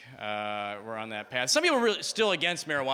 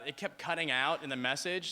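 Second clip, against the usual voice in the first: ordinary delivery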